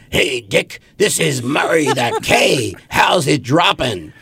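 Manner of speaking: high, drawn-out radio DJ voice; Murray the K. Acted. Radio DJ greeting a young writer on the phone, hammed-up on-air voice. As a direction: stretch it out like a radio host on air. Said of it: "just like on the radio, the voice goes".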